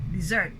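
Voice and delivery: "desert" said dih-ZERT; second-syllable stress. Shown as a demonstration. Teacher on abandoning, "desert" stressed on ZERT. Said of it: "so to abandon".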